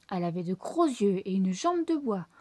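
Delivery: plain reading voice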